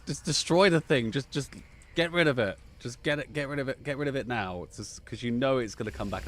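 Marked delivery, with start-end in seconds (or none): 0.0-4.6 insistent mock-panicked plea; urgent pleading. Player recalls begging mid-mission, urgent pleading. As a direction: urge them on, louder and higher at first. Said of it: "I was sort of like".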